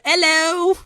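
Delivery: high mock British greeting; bad British accent. Recalling her earlier joke, bad British accent. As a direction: chirp it in a terrible British accent. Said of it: "that's the modern equivalent of".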